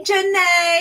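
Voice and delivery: ordinary talk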